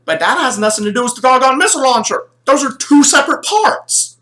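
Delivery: acted kid's fed-up objection; exasperated protest. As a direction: argue back at papa, exasperated. Retold argument with papa.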